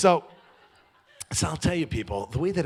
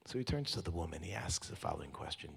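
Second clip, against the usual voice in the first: ordinary talk, nothing marked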